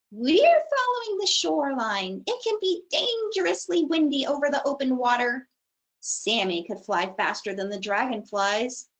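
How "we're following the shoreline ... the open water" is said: high insect voice. Acted. Darner explains.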